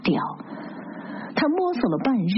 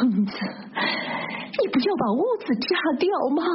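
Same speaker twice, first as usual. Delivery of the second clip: higher-pitched scolding; reproach; 5 semitones higher than usual. A narrator's reading. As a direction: scold as if to scare some sense into her. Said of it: "他责备他，". (责备威吓的口气)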